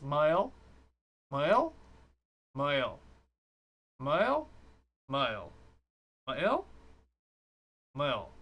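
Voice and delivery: Moel tried again and again, sometimes rising, sometimes falling; trying a name. Shown as a demonstration. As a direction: keep testing how to say Moel. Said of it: "Watch this".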